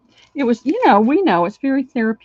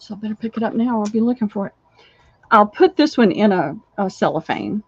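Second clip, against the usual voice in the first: usual speaking voice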